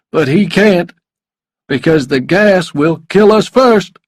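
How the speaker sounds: forceful villain voice, rising to wild triumph; desperate drinking lawyer. A narrator's reading. Narrator plays desperate lawyer.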